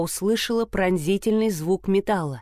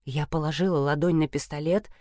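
ordinary delivery, nothing marked

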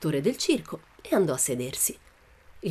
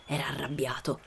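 plain reading voice

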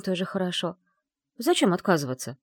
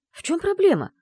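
high, loud, baffled demand; exasperated; 13 semitones higher, 6 dB louder than usual. A narrator's reading. Secretary baffled by the lord's refusal, exasperated. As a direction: demand an answer, voice jumping up.

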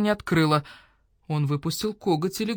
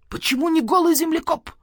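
much higher, louder disbelieving question; can't believe what he hears; detective baffled by the owl's answer; incredulous; 11 semitones higher, 4 dB louder than usual. Acted. Badger cat questions in disbelief.

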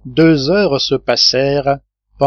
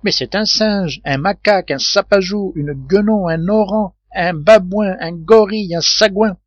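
exasperated listing; 6 semitones higher than usual. Acted. Sailor listing apes, exasperated.

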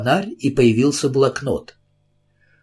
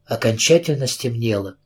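plain reading voice

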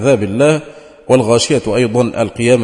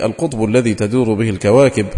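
ordinary talk, nothing marked

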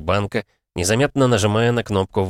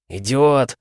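higher, exasperated outburst; involuntary exasperated exclamation; blurted insult; 7 semitones higher, 4 dB louder than usual. A narrator's reading. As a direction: let the insult burst out, short of a shout. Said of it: "вырвалось у Мортона".

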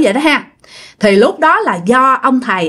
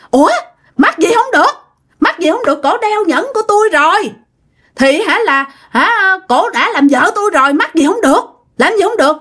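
defiant ghost; 8 semitones higher than usual. Retold or imitated. Ghost husband defying the exorcist, high and indignant. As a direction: snap back in outrage, refusing to leave.

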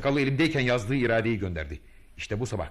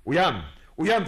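commanding wake-up call; 7 semitones higher, 5 dB louder than usual. Retold or imitated.

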